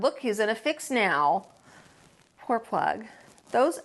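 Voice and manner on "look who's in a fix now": ordinary delivery, nothing marked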